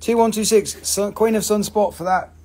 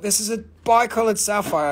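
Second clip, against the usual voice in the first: ordinary talk, nothing marked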